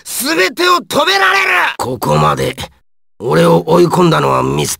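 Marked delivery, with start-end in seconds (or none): 0.0-1.5 high, forceful battle boast; boastful shout. Acted. Boasting that his power is invincible, exultant shout. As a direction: shout it as absolute triumph.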